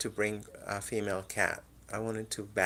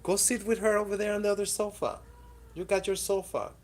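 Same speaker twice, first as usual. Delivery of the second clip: high, fast exasperated scolding; scolding the cat; 8 semitones higher, faster than usual. Real, not acted. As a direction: tell the pet off sharply. Scolding the cats on sofa.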